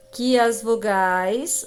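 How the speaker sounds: usual speaking voice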